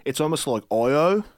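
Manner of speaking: broad Australian with long O's; Australian accent. Shown as a demonstration. Demonstrating how Australians talk, Australian accent with long O's. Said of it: "Australian accent".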